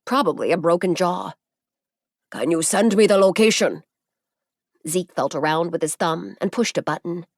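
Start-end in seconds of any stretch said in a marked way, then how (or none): 2.3-3.8 put-on Masaru in a foreign accent, louder; heavy accent. A narrator's reading. Masaru asks Zeke for his location, in a foreign accent. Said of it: "Masaru said in his heavy accent".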